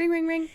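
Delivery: high sing-song ring, ring, ring; phone ring imitation.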